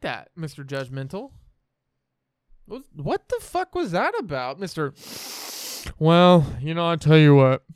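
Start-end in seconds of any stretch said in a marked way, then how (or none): 2.7-7.6 mock outrage. Real, not acted. Teasing friend over a sigh.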